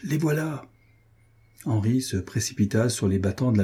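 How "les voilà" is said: high, held-back warning cry. A narrator's reading. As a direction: warn the king in a contained shout. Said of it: "criait la Guiche".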